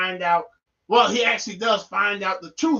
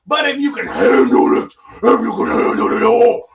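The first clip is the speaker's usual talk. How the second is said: loud, raspy ghoulish roar; hammed-up spooky bellow; carried away; 8 dB louder than usual. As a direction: build into a loud monster growl. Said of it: "I just keep getting carried away".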